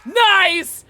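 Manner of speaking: loud triumphant cheer; excited shout. Real, not acted. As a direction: yell it out in delight. Excited by good play.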